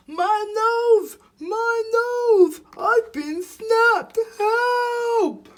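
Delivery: very high, loud squeals for help; panicked shouting. A narrator's reading. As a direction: scream as a trapped young elephant. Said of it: "The animals heard Elephant shouting".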